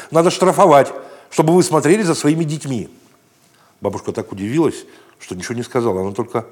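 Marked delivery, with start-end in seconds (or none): 0.1-2.9 loud, higher scolding tone; indignant lady. Acted. Dog's owner blaming the boy before the guard, loud and indignant. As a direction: lay the blame on the boy haughtily. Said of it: "сказала она бабушке".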